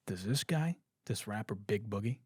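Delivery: usual speaking voice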